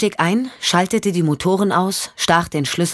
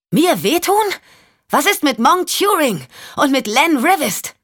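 much higher, biting disbelief; Michelle, scornful; 10 semitones higher than usual. Acted. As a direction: throw his words back at him. Michelle scoffs at his threat.

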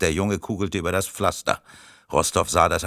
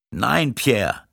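higher, restrained cry; calling out; 5 semitones higher than usual. A narrator's reading. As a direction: call out to stop him. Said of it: "rief der Ältere". (verhaltener Ausruf, höhere Stimme)

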